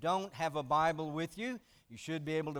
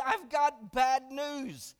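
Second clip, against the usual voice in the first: slow, drawn-out tearful confession; a touch of weeping, slowed right down; tearful wife; 5 semitones higher, 3 dB louder, much slower than usual. Retold or imitated. Wife crying to her husband, slow and mildly tearful. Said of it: "She's, she's crying uncontrollably".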